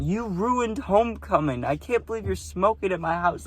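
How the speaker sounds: mock girl scolding, high-pitched; imitating mad girl. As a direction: drag it out like a scandalised hostess. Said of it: "she went".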